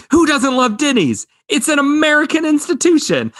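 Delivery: high-pitched, emphatic movie quote; mock enthusiasm. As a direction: proclaim it high with exaggerated zeal. Quotes film character championing Denny's.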